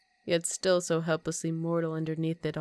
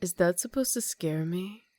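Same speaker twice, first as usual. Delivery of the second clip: usual speaking voice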